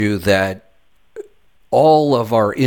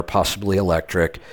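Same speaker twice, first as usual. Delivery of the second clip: ordinary talk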